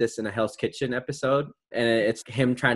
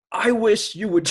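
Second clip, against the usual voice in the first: raised, attempted British chef voice; Gordon Ramsay; 7 semitones higher than usual. Host playing an insulting chef for the quiz, half-hearted impression. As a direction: pitch it up as a mock scolding chef. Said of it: "You're impersonating Gordon".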